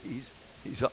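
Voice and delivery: chuckling through the words; laughing. Real, not acted. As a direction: laugh softly as you speak. Laughs while preaching about Moses.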